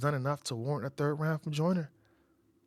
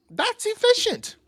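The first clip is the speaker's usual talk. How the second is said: very high, slow, drawn-out praise; much higher impressed repeat; impressed squeal; 5 dB louder, slower than usual. Real, not acted. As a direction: stretch it up high in awe. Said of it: "repeated higher".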